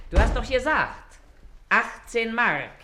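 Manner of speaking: a wife calling the price loudly to her husband; speaking up. Acted. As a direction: make the hard-of-hearing husband hear it.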